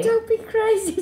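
put-on high accented echo of the rep; mimicked resort rep. Co-host echoing the holiday rep's line, high mock-accented voice. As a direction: parrot the entertainer's high-pitched catchphrase. Listener echoes the rep impression.

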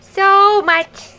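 put-on high baby-talk hello; cooing hello, drawn out; high sing-song greeting. Answering for baby.

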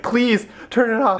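beg, as if you can't bear it; pleading. Retold or imitated. Retelling a reaction to the sad commercial, pleading. Quotes own plea at the commercial.

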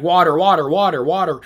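quick repeated mimicking taunt; mocking. Guy mocking the American's way of saying water, mocking. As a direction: parrot the American pronunciation. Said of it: "he was mocking me".

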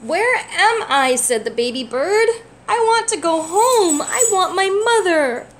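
high, loud, slow sobbing words; crying. Acted. Lost baby bird wanting its mother, crying. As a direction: cry it out like a lost little bird. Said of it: "baby bird's starting to cry now".

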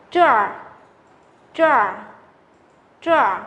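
"trở" said with the tongue curled, as zhèr; teacher drilling the curled "trở"; retroflex drill. Shown as a demonstration. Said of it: "chúng ta sẽ phải uốn lưỡi".

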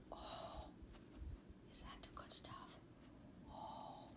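breathy whisper to the dog; hushed cooing to a pet. Real, not acted. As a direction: whisper as if sharing a secret treat.

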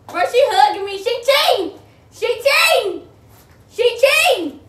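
high, loud repeated outcry; loud accusation. Real, not acted. Player accusing her wrapper of cheating, loud accusing cry.

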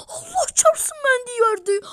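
high, loud shriek of joy; excited yell. Real, not acted. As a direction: yell it out in disbelief. Rare character pulled from reward. (heyecanla bağırarak)

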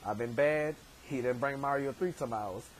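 ordinary delivery

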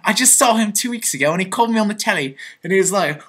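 put-on chatty London English accent. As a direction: confess in a lively southern English accent. Confesses to being English in changed accent.

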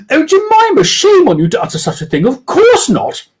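high, loud falsetto mock outrage; hammed-up indignant lady; offended posh lady. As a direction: act shocked at the suggestion. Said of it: "doing women's voices".

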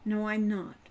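ordinary delivery

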